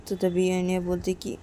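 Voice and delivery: ordinary delivery, nothing marked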